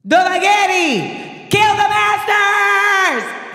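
high, loud, slow battle cry; hammed-up queen's war cry; commanding shout. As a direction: call the army to strike. Daenerys commands army.

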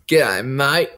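low Aussie bloke greeting; lightly deepened macho mate voice; put-on masculine voice. Shown as a demonstration. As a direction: put on a manly low g'day. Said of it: "Can you do your most masculine voice".

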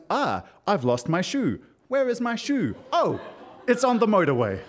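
lightly hammed-up panic, pitch jumping up; mock alarm. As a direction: act out a silly fuss over a lost shoe. Acting out shocked search for shoe.

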